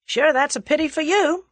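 loud, high, breezy threat; cheery American, voice lifted; cheerful. A narrator's reading. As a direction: dismiss him cheerfully. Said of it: "said Julius cheerfully".

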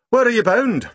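a curious constable; london policeman. Acted. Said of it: "he asked".

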